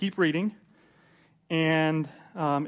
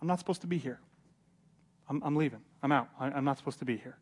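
quietly overwhelmed; 7 dB quieter, faster than usual. Acted. Paul, overwhelmed at the synagogue, decides to leave, quietly overwhelmed. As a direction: back out softly, almost to yourself. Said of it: "he is kinda overwhelmed and goes".

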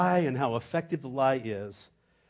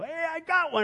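high excited call, much higher; new guy calling out. Retold or imitated. New guy on the crew announcing his snake, high excited call. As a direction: yelp it out like a proud rookie. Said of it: "he'd go".

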